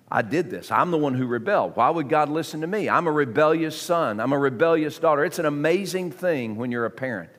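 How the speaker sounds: ordinary talk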